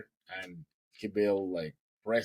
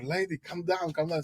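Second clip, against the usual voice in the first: higher voice, soothing doctor imitation; doctor calming her; 8 semitones higher than usual. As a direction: talk a frantic mother down.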